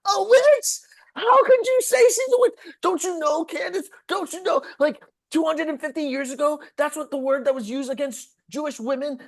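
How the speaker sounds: high, loud, whiny indignation; mock outraged rabbi. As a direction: parody a shrill offended man, building up. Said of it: "He's like".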